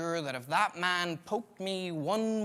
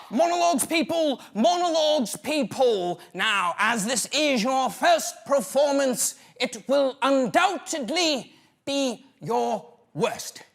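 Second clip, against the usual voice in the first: hammed-up drama teacher; 6 semitones higher, 7 dB louder than usual. Acting teacher announcing monologues.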